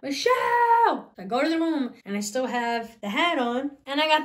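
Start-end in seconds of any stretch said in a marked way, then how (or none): 0.0-1.0 high, loud mom yell across the house; screaming for her kid to come here; mom screaming a name. Retold or imitated. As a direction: call the name out like an angry mom from another room. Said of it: "my mom starts screaming my name".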